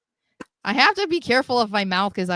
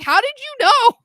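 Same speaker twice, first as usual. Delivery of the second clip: surprised squeal; 9 dB louder than usual. Retold or imitated. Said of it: "I was like".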